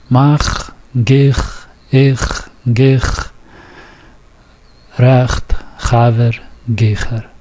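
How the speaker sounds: "mach", "gich", "recht", "chaver" and "gicher" each with a guttural ch from the back of the throat; throaty ch sound. Shown as a demonstration. Teacher modelling the Yiddish ch for students to repeat. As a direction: rasp the ch at the back of the throat in every word. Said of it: "with the back of your throat".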